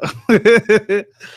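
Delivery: real burst of high laughter; loud high giggle. Real, not acted. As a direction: laugh up high at your own joke. Laughs at own remark.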